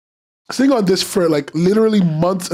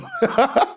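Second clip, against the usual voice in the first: laugh; 9 semitones higher than usual. Real, not acted. Laughs at own rant.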